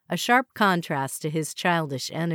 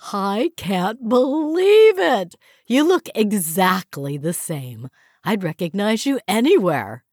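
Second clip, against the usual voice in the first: booming enthusiasm; 4 semitones higher, 6 dB louder than usual. Acted. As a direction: boom it out, exaggerated. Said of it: "booming voice and exaggerated enthusiasm".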